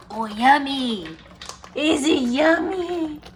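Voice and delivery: high, slow, sing-song question; cooing pet talk. Real, not acted. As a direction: coo sweetly to a dog as it eats. Cooing to her eating dog.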